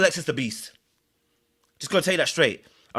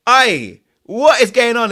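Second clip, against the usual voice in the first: loud, high, baffled cry; exasperated outcry; 6 semitones higher, 9 dB louder, slower than usual. Real, not acted. Loud, high exasperated cry as a streamer loses his English mid-chat. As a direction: throw up your hands and shout it.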